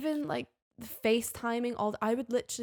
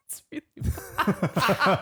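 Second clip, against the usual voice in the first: laughing hard; 4 dB louder, much slower than usual. Real, not acted. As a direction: crack up while saying it.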